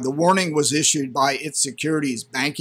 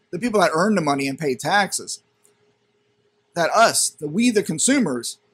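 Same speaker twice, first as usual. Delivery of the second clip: ordinary talk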